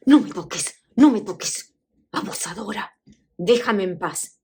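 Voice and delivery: bursts of loud rebuff; acted angry mother. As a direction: push her away with sharp, acted outrage. Mother pulls away, fists raised.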